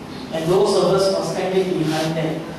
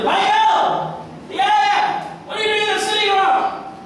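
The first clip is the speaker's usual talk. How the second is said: high, mocking jeer from the crowd; high jeering call to the sculptor; mocking shout; 14 semitones higher, slower than usual. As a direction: taunt him across the yard. Mockers shouting at Michelangelo.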